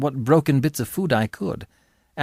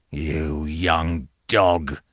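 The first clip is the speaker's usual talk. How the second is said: slow, low, menacing growl; low growling convict; 10 semitones lower, much slower than usual. Acted. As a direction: drag it out like a hungry brute. Convict sizes up the boy.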